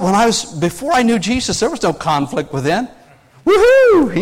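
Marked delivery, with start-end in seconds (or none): 3.5-4.2 a sudden high, loud party whoop; mock whoop. Preacher mocking his old party self with a whoop. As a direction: whoop like a carefree young Marine.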